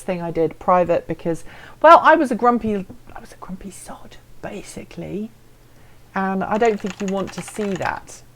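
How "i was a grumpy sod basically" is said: hushed, embarrassed aside; sheepish whisper. Real, not acted. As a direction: drop to a whisper for the confession. Confessing past grumpiness, aside.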